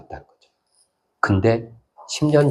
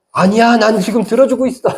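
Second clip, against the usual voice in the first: high, loud insistent denial; emphatic acted rebuttal; 13 semitones higher, 5 dB louder than usual. Preacher voicing God answering a doubter's prayer, emphatic high protest.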